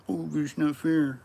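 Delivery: loud, high-pitched crybaby whine; mock a whiner at the top of the voice; hammed-up petulant complaint. Mimics whining about fairness.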